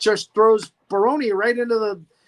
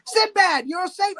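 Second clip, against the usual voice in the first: high-pitched hammed-up call to the hero; mock relieved cry; 11 semitones higher, 4 dB louder, slower than usual. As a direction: call out high, playing overjoyed. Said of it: "they were like".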